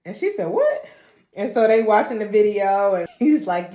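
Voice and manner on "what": squeaked high, startled what; mock stunned what; high shocked what. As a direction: jolt up in shock. Ava shocked.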